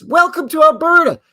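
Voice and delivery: high, loud grand welcome; mock announcer. Driver crossing into Alberta, loud high mock welcome. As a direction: announce the border like a big cheerful sign. Border sign greeting.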